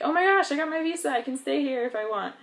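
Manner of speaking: high, fast, thrilled gushing; excited news. Retold or imitated. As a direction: burst out with happy news. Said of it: "I was so excited and thrilled".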